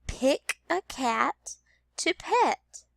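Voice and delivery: slow, every word clear, Pick a cat to pet; slow teacher read-aloud; slow modeled sentence. Shown as a demonstration. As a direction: read it slowly for children. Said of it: "The first sentence is".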